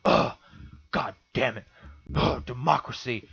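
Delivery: imitated fuming, with heavy groans; huffing and puffing. Imitating a voter heard across the room, huffing and puffing. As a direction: huff and grumble curses between groans. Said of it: "hear him ... huffing and puffing".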